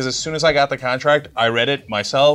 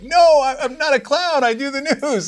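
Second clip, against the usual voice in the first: much higher, loud defensive denial; high indignant protest; 14 semitones higher, 3 dB louder than usual. Retold or imitated. Nephew protesting to his uncle that he does news. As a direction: squeak out your defense like an insulted kid.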